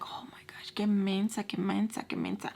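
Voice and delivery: hushed, breathy muttering; exasperated whisper under the breath, repeating itself; muttered disbelief. Retold or imitated. Man muttering at her foolishness, hushed exasperation. Imitates his muttered scolding.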